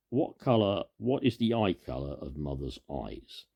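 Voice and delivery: usual speaking voice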